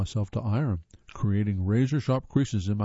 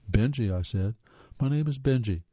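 usual speaking voice

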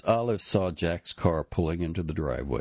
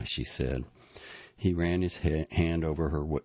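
ordinary delivery, nothing marked

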